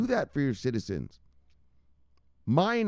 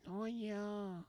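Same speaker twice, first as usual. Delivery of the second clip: eerie voice; 10 semitones higher, 14 dB quieter than usual. Pretend. Hypnotised voice.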